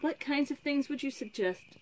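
high, mildly stung question. A narrator's reading. Princess to the scolding frog, stung. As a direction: ask back as if a little hurt.

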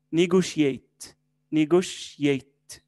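"نيجوشيت" slowly, as ni-go-shi-ate; slow teacher's model of "نيجوشيت", SH in the middle. Shown as a demonstration. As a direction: draw out "نيجوشيت" with a clear SH for the T. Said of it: "التي هنا بننطقها ش".

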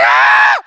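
short, loud startled cry of Ah; startled yell. Retold or imitated. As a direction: bark out a sudden shocked Ah. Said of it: "He's like".

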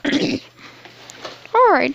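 put-on throat cleared, light old-lady voice; grandma voice. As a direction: settle back into playing granny. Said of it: "Let me get my grandma voice back on".

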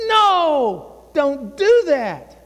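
high, slow, gentle plea of the inner voice; high pleading inner voice. Acted. Pastor voices the inner voice warning against quitting, high and slow. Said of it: "was there some voice saying".

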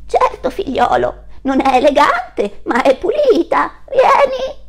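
cheerful landlady greeting a guest; smiling mother goat. Acted. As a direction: chuckle and invite him in warmly. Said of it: "grassottella e sorridente".

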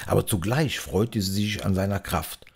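plain reading voice

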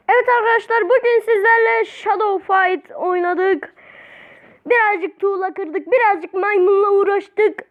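chanty YouTube outro voice; vlogger outro. Real, not acted. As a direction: sign off in a sustained announcing tone. Video sign-off recap.

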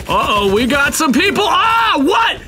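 very high incredulous shouting; high screech of outrage; outraged shock. Real, not acted. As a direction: yell in disbelief at being flattened. Run over by a horse.

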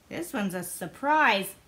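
ordinary delivery, nothing marked

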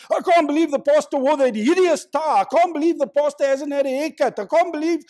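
hammed-up moaner piling complaint on complaint; complaining churchgoer. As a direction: rattle off petty gripes like a spoiled member. Mocking grumblers' string of complaints.

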